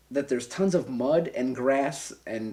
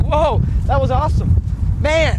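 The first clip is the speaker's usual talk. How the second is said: high, loud re-enacted thrill; 11 semitones higher, 9 dB louder than usual. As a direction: whoop it out, riding the rush. Retells thrill of the jump.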